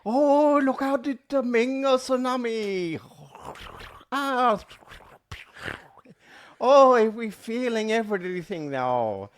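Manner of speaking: high, hammed-up Japanese accent; mock Japanese accent. Joking with co-host about a tsunami of feelings, mock Japanese accent. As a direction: wail it in a caricatured Japanese accent.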